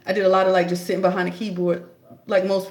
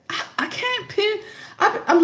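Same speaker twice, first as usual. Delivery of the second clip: talking through real laughter, higher; laughing; 13 semitones higher than usual. Real, not acted. Laughing while admitting he listens to [name] daily. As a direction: giggle through the words, pitch up.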